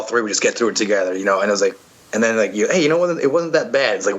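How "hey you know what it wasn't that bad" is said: surprised relief. Retold or imitated. Relieved inner voice after obstacle.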